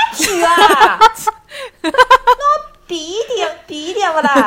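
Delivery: loud, high shocked shopper begging a discount; haggling customer. Pretend. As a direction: gasp at the price and bargain. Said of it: "说一遍这个对话".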